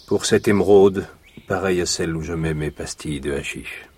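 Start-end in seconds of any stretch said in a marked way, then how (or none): 1.5-3.9 confiding whisper. Acted. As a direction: drop to a voiceless, secretive whisper. Count's aside about hashish box.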